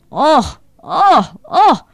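rising, loud mocking oh oh oh; mocking cheer. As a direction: whoop it up to mock them. Drunk Fatoş cheers mockingly. (alaycı, yükselen coşkulu nara)

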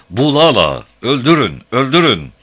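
high, loud battle shout; war cry. Acted. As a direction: scream the command to kill. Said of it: "bir savaş çığlığı atarak".

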